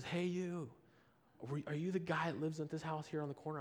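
retold street call; acted calling out. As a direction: imitate someone hailing a half-known stranger.

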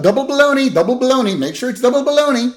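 high, insistent goofy chant; Homer. Retold or imitated. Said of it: "this is when Homer's like".

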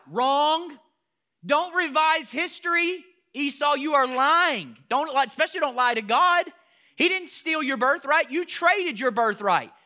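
loud, high, slow scolding; preacher scolding Esau at full force; stern rebuke. Real, not acted. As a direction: call out the liar.

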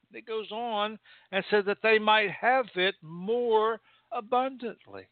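high, ringing sermon reading; fervent preacher; preaching. Acted. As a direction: proclaim the verse, stretching it out. Preaching at full force.

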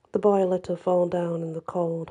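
usual speaking voice

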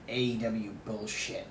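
ordinary talk, nothing marked

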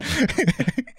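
short high laugh; amused chuckle. Real, not acted. Storyteller after threatening the dog owner, real laugh. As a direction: chuckle at your own nerve.

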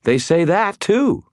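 high, loud insistence; emphatic rebuttal, rising high; insistent. A narrator's reading. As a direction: push the point hard, voice climbing. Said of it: "Elaine insisted".

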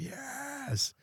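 high, soft mock cheer; imitating the lads' high little yes. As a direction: squeak out a tiny impressed yes. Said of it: "And we're just like".

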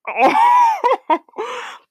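high bursting laugh at herself; self-mocking laugh. Real, not acted. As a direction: burst out laughing at your own irony.

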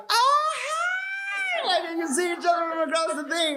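high-pitched squealed greeting; pitch goes up. Retold or imitated. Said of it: "the pitch goes up".

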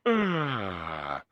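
low, soft yawning sigh; quiet yawn. Shown as a demonstration. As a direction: let out a low, muted yawn. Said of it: "I yawn, I go".

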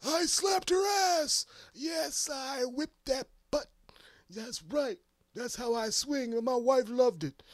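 high, loud, slow bragging voice; boastful Bernie. Acted. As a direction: brag it proudly to win the crowd. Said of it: "come out and say".

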